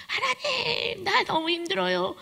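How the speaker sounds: high, slow crying prayer; tearful cry to God. Retold or imitated. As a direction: sob it out to God. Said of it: "한 명이 울기 시작하니까". (높고 느리게 울며 하는 기도)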